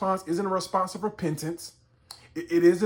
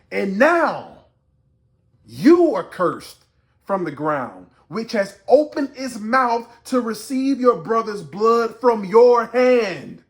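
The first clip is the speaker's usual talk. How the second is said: loud, measured pronouncement of a curse; forceful preaching; 6 semitones higher, 8 dB louder, slower than usual. Acted. Preacher voicing God cursing Cain, loud and weighty. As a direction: land each key word hard.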